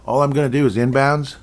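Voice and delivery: ordinary talk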